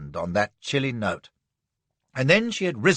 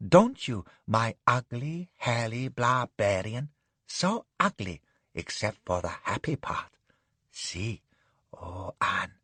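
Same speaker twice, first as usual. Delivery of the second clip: tipsy bliss; 2 semitones lower, 4 dB quieter than usual. Acted. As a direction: coo at her barbarian. Madam tipsy happy.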